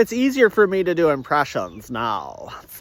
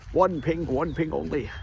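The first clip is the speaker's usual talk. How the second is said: Russian commander; 6 dB quieter than usual. Retold or imitated. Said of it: "Russian shop commander".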